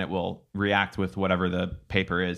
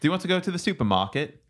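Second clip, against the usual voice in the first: higher-pitched British English; British accent; 7 semitones higher than usual. Shown as a demonstration. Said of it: "who had a better one?".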